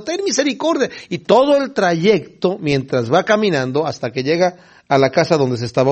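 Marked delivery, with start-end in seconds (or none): 0.0-0.9 crying out. Retold or imitated. Blind men still following as he walks, mock crying out. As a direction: imitate a call from behind, pitched up. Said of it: "aquellos siguen gritando".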